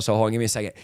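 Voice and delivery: quiet, low, holding back tears; pausing to get his composure, real emotion; choked up. Real, not acted. Telling of his childhood hanging, he chokes up quietly. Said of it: "I get all emotional talking about it".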